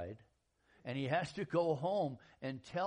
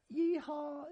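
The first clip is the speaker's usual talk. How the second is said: high, loud whoop of yeehaw; yeehaw cheer; 12 semitones higher, 5 dB louder than usual. Acted. Preacher whooping to the congregation, high yeehaw. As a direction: cheer it out like a church amen.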